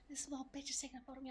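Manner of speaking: very soft, fast muttered aside. Real, not acted. Streamer muttering about a man photographing her, soft and fast. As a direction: rattle it off under your breath.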